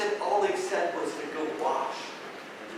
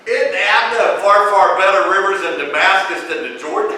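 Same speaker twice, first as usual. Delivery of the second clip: indignant Naaman; 4 semitones higher, 10 dB louder than usual. Retold or imitated. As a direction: sound insulted by the muddy river. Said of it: "one of the funniest things in the Bible".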